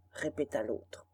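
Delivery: usual speaking voice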